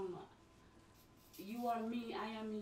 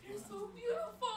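high, crying voice; tearful praise; 12 semitones higher, 5 dB louder than usual. Real, not acted. Crying mother praising her idol, high and tearful.

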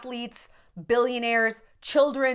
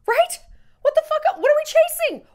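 tearful outburst; 8 dB louder than usual. Real, not acted.

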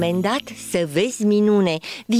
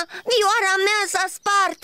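high, slow, crying wail; sobbing wail; 10 semitones higher, slower than usual. Acted. Cricket mourning his broken violin, slow sobbing wail. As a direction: break into tears over it. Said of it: "a strigat îngrozit".

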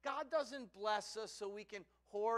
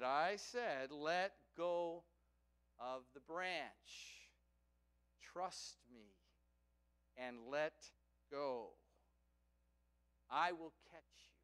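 slow, lowered, measured voice; calm insistence; 4 semitones lower, slower than usual. Acted. God tells the hanging man to trust, slow and calm. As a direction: reassure with deliberate pauses between phrases.

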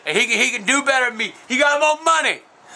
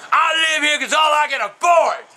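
high, shouted protest; indignant raised-pitch outburst; getting mad; 4 semitones higher than usual. Real, not acted. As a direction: yell it in exasperation. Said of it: "I'm getting mad".

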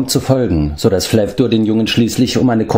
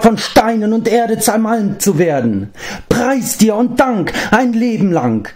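much higher, screeching grovelling creature; screeching Gurgi; 14 semitones higher than usual. A narrator's reading. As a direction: shriek thanks while grovelling on the ground.

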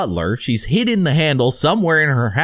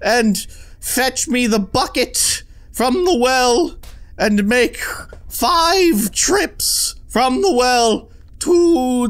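put-on high, slow, haughty old lady giving orders; rich granny voice; 10 semitones higher, slower than usual. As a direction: drag out each demand like a pampered rich grandma. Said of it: "The rich granny voice".

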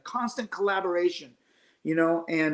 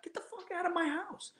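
inner outburst; 11 semitones higher, 12 dB quieter than usual. Retold or imitated. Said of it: "first instinct was like".

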